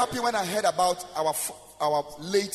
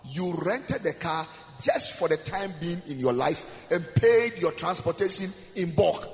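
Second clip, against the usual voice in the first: ordinary talk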